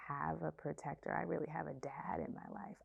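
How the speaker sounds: awed whisper about her dad; real hushed whisper. Real, not acted. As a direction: breathe it out in awe. Emotional testimony, whispered.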